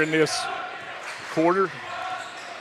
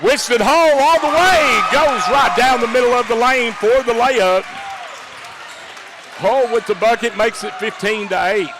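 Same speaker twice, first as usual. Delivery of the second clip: loud, high, excited call; excited play-by-play; 7 semitones higher, 4 dB louder than usual. Real, not acted. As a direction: call the fast break loud.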